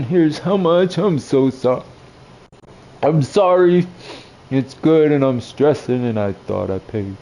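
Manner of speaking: lightly voiced old man, fretting; a hint of a fussed grandpa; apologetic old man. Old man apologizing to the waiter, lightly voiced. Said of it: "old man apologizing".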